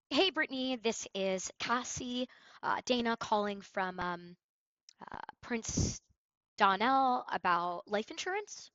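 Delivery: slow, hesitant, halting flat read full of ums; bad flat intro. Shown as a demonstration. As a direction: stumble through like a bored cold caller. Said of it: "Ew, that's disgusting".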